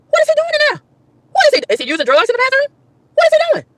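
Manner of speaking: high-pitched baffled questioning; incredulous. Retold or imitated. Woman wondering about mover in bathroom, incredulous. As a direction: wonder in a squeaky, disbelieving voice.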